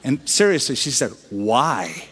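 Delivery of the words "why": low, flat, disbelieving "Why?"; incredulous. Retold or imitated. Woman in line hears he's a pastor, low incredulous. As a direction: imitate her stunned disbelief. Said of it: "Just like that".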